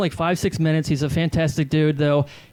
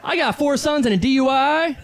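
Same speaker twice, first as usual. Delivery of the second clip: hack club comic; 10 semitones higher than usual. Acted. Comic in character working the crowd, brash high shouting voice.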